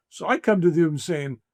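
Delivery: normal talking voice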